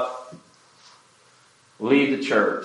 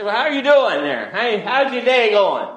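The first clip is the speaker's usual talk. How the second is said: higher, bright friendly hello to a stranger; cheery greeting; 10 semitones higher, 3 dB louder than usual. Retold or imitated. Preacher chatting up strangers in the hospital elevator, cheerfully. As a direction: greet them like a chatty neighbour.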